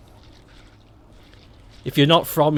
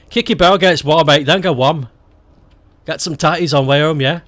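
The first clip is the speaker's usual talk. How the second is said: broad Stoke slang, "barrel"; Stoke dialect; 4 dB louder than usual. Shown as a demonstration. Teaching a Stoke phrase in full dialect. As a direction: say it like a local lad. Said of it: "I shall buy some potatoes".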